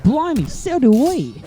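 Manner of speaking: high, drawn-out Australian exclamation; hammed-up, slow Australian put-on; mock Australian accent. Jokey Australian reply in retold story.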